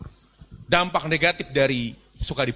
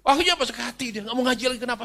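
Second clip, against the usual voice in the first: ordinary talk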